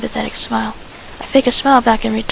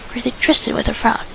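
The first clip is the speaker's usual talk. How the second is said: usual speaking voice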